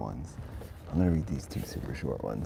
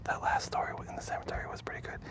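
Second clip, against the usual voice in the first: soft unvoiced whisper; 7 dB quieter than usual. Real, not acted. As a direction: whisper so nobody wakes. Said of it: "I'm going to whisper so I don't wake up the neighbors".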